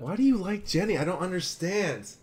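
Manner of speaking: high-pitched, baffled protest; exasperated disbelief. Real, not acted. Exasperated at friend liking a character.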